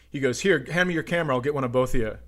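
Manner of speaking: normal talking voice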